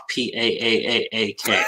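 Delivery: "P A A A A K" spelt out as if the word had a long A and no R; teasing drawn-out spelling. Pretend. Host teasing a guest about park, mock spelling of an accent. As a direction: spell out the stretched accent, letter by letter. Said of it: "So you spell park".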